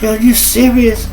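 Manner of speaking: high, stunned question; retold disbelief. Hearing the girl is homeless, high stunned disbelief. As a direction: leap up high in genuine shock.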